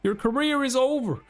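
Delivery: high-pitched gleeful mockery; gloating taunt. Character taunting the beaten executive, high gleeful jeer. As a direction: rub the loss in his face.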